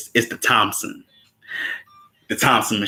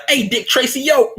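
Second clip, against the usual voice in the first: much higher, teasing call-out; hammed-up cocky kid calling out; cheeky mock voice; 4 dB louder than usual. Voicing a young gang member.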